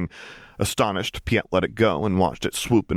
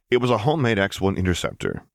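ordinary delivery, nothing marked

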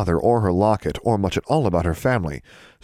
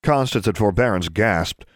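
usual speaking voice

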